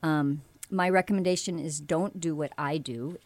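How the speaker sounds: ordinary delivery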